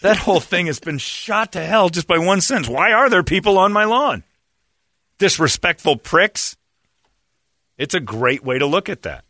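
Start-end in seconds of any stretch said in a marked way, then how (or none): 0.0-6.6 fired-up agreement. Retold or imitated. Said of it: "I'm like".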